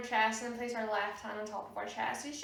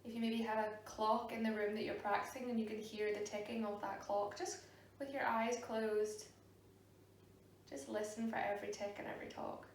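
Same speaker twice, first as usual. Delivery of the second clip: soft, unhurried meditation voice with long pauses; guided meditation; 8 dB quieter than usual. Real, not acted. Yoga teacher guiding a class to sit and breathe, soft meditation voice. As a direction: ease them gently into stillness, growing quieter.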